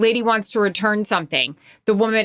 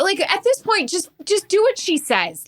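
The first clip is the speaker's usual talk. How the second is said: much higher-pitched impatient plea; exasperated; 10 semitones higher than usual. Real, not acted. Waiting behind an arguing customer, exasperated. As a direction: plead for them to get on with it.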